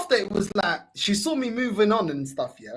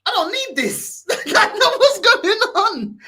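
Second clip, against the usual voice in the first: much higher, drawn-out incredulous cry; laughing protest; 12 semitones higher, 5 dB louder, slower than usual. Retold or imitated. Man retelling his thoughts mid-encounter, high laughing protest. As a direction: act out bewildered protest, laughing through it.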